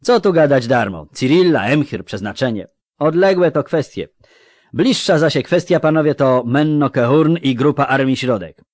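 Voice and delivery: loud, high, dismissive dwarf; brusque dwarf. Acted. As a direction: wave the idle talk aside. Voices dwarf Skaggs dismissing talk.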